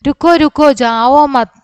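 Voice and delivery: little kangaroo calling the bird back. Acted. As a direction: shout after the bird to stop. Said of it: "രൂരുവിനെ ചില്ലായ".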